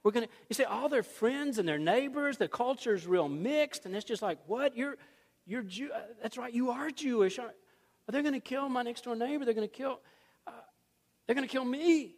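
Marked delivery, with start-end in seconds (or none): 4.5-12.1 higher, rushed alarmed voice; a touch of hammed-up panic; mock panic. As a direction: act out a frightened neighbor. Neighbors panicking over the decree.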